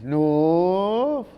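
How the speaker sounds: "North" stretched long, pitch sliding up; proud, elongated shout-out on "North"; emphatic drawn-out NORTH. Real, not acted. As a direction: hold "North" and let it climb. Proudly repeats his part of town.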